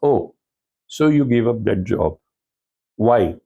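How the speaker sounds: pointed, suspicious questioning like an interrogator; interrogative questioning. Shown as a demonstration. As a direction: grill him on why he quit. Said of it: "very interrogative style of asking".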